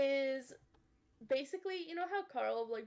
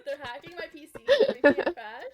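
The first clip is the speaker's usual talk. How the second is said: mock gamer complaint growing loud; too-serious gamer; 4 semitones higher, 12 dB louder than usual. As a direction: whine higher, then burst out louder. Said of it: "that's how people always talk when they".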